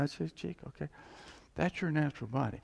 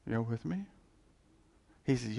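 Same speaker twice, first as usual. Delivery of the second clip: normal talking voice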